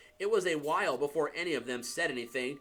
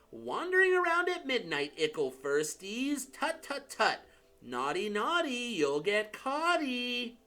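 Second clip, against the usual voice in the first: cackling poltergeist; 8 semitones higher than usual. Acted. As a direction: tease them like a gleeful little ghost. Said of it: "Peeves cackled".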